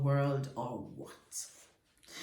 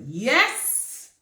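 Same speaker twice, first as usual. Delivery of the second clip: high, loud cry of triumph; joyful shout; 7 semitones higher, 10 dB louder than usual. Acted. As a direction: shout it out in delight. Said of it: "he shouted".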